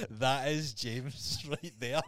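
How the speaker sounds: slow words broken by laughter; laughing, drawn-out delight. Real, not acted. Laughing at the clip.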